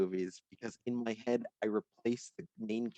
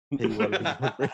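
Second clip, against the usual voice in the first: faster, laughing repeat; eager and laughing; 5 dB louder, faster than usual. Pretend. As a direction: rush it with a laugh. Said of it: "Matt Park is going up to kids like".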